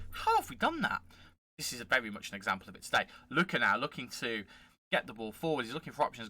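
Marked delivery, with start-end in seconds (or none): 0.1-1.0 very high, squeaked disbelief; high incredulous question; mock astonishment. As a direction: imitate his own stunned reaction. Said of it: "followed by me going".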